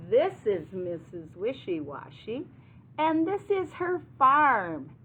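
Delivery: animated picture-book narration; story reading to children. A narrator's reading. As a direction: present each thing to young listeners with emphasis.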